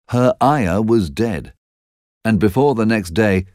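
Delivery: plain reading voice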